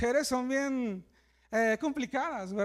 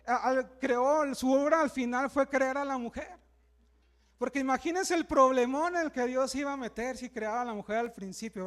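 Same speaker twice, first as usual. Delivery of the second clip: normal talking voice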